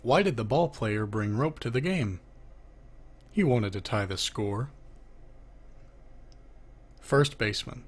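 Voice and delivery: ordinary talk, nothing marked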